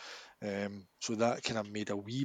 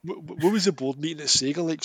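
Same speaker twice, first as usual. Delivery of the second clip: ordinary talk, nothing marked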